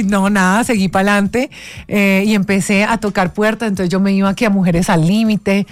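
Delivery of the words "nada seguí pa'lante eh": ordinary talk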